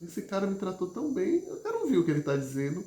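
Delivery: acted appreciative listener, lifted pitch; imagined grateful listener. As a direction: voice a listener won over by kindness. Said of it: "É assim como você dissesse assim".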